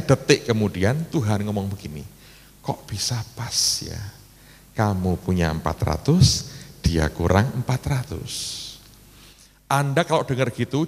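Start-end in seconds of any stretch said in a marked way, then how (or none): 2.6-8.3 slow, low, measured quote; hint of a calm, low divine voice; voice of God. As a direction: say it slowly and low, lightly voicing God. Acting out God speaking to him.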